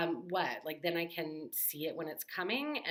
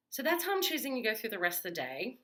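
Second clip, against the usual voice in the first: ordinary delivery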